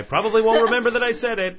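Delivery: high, loud and fast; cheeky loud aside; loud laughing punchline. Real, not acted. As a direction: blurt out the self-mocking joke. Self-mocking punchline.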